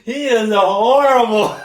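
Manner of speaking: drawn-out scolding of a bad kid; mock scolding. Staff mocking the bad student, slow, loud mock scolding. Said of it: "and they're like".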